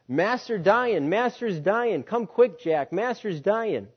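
high, loud, slow alarm call; urgent cry. Acted. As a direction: call out the dying master's news in a panic. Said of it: "a messenger came down to the cotton field crying".

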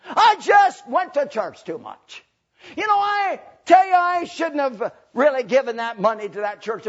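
loud hammed-up regret, easing off; mock complaint. Preacher mimics what Christians won't say, loud mock complaint. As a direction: start each complaint high and loud, then drop. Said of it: "We're not gonna say this".

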